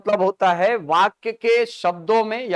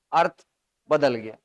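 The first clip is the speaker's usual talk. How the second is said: usual speaking voice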